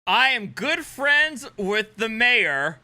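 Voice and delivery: high, slow mock boast. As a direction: parrot his boast slowly and pointedly. Mockingly repeats the man's boast.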